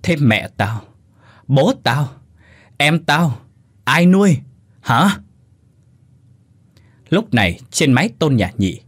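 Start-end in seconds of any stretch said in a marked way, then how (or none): 0.0-5.2 hateful demand. Acted. Bích raging at Sương over who will feed her family, building fury. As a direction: spit the list out, rising to a hateful shout. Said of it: "căm thù nhìn Sương nói rõ ràng".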